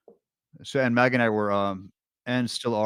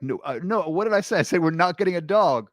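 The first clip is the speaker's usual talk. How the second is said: retold firm no, pitched up and louder; mock-exasperated, emphatic telling-off; exasperated parental refusal; 8 semitones higher, 6 dB louder than usual. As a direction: act out a parent reminding the kids. Parent refusing crying girls' plea.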